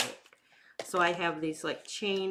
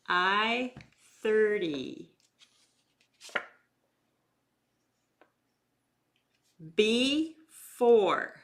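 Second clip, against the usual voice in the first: slow, loud bingo number calls; bingo caller; 2 semitones higher, 6 dB louder, much slower than usual. Acted. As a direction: call each number out slowly, then repeat. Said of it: "four rounds of bingo".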